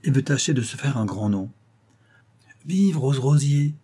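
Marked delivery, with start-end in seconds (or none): 2.7-3.8 loud, slow cheer with pitch up; crowd cheering. A narrator's reading. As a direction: call out her name like a toast. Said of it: "cria-t-on autour de la table".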